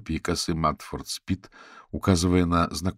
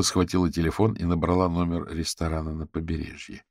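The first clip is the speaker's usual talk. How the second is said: ordinary delivery, nothing marked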